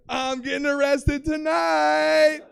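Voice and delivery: high, loud, slowly stretched wail; panicked shout. Retold or imitated. Tripping man at checkpoint, panicked wail. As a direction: wail it, dragging out the last word.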